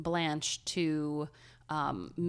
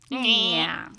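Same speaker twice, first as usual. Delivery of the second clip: loud, high nasal "yeah"; exaggerated nasal; 4 semitones higher, 7 dB louder than usual. Shown as a demonstration. Host demonstrating thick Cleveland accent, loud and nasal. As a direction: honk it through the nose, louder. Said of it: "Like nasally".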